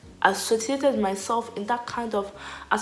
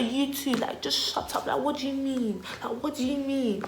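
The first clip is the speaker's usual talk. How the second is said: high-pitched indignant retort; retold exasperation; 6 semitones higher than usual. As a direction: imitate fed-up disbelief, pitched up. Acting out exasperated retort to boys.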